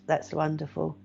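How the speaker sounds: ordinary talk, nothing marked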